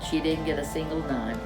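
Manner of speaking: ordinary delivery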